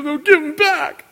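high, loud whiny voice; petulant mock whining; whiny complaint. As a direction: whine like a robbed child. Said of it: "so he complains".

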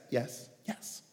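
fading to a whisper; whispered yes. Real, not acted. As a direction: let the last yes die away unvoiced.

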